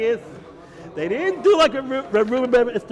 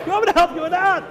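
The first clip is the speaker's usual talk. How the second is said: repeated much higher and pushed, exasperated; the demon losing patience, voice jumping up; insistent demand; 9 semitones higher, faster than usual. Acted. The insulted shade presses Reb Shimon, higher exasperated repeat. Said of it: "the shade got all insulted".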